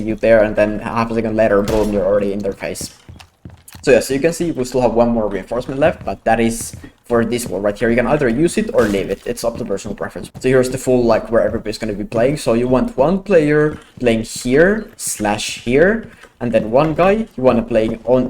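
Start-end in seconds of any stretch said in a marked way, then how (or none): none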